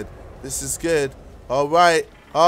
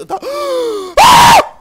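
sharp shocked gasp; loud gasp; 9 dB louder than usual. Real, not acted. Huge gasp on landing.

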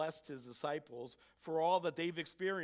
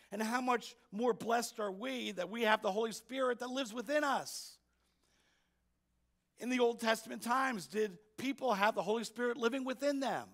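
higher, louder, insistent rhetorical questions; preacher pressing the congregation, fervent raised preaching; 8 semitones higher, 7 dB louder than usual. Real, not acted. Preacher urging his church how blessed they are, raised fervent voice.

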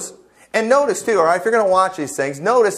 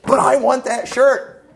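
mock whiny wife; 6 semitones higher, slower than usual. Wife whining for shirt.

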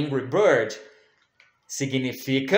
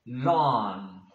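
normal talking voice

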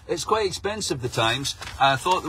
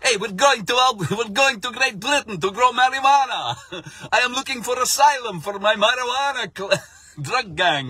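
mock Italian accent; 7 semitones higher than usual. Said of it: "they've got an Italian accent".

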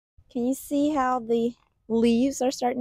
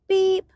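beep; 10 semitones higher, 6 dB louder than usual. Acted. Bus horn sound in picture book.

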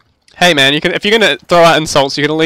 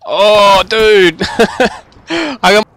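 loud, higher "oh, dude!"; excited outburst; 6 semitones higher, 6 dB louder, much slower than usual. Real, not acted. Reacting to a chat message with a loud excited yell. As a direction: burst out, thrilled and loud.

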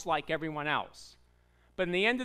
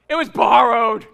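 dramatic reveal; 3 semitones higher, 12 dB louder than usual. Acted. Pastor reveals the worst part, loud and dramatic. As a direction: punch it out much louder, like bad news. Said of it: "here's the worst part".

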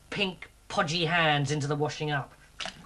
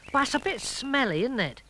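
higher-pitched little frog; high, mildly doubtful frog; Sylv's character voice; 6 semitones higher than usual. Acted. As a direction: lift the pitch for a small storybook character. Frog Sylv's line in children's story.

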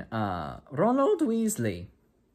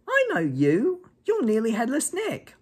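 much higher, slow, louder eager voice; excited first-year; 13 semitones higher, 5 dB louder, slower than usual. Acted. First-year recognizes the ghost at the table, high and eager. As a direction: point him out with delight.

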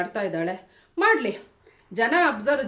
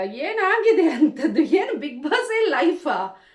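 slow, higher, louder incredulous questions; exasperated disbelief; 4 semitones higher, 4 dB louder, much slower than usual. Real, not acted. Vlogger scolding a crying contestant in drawn-out disbelief.

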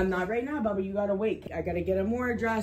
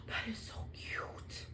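gasping whisper; 16 dB quieter, slower than usual. Real, not acted. Gasping over baby outfit gifts.

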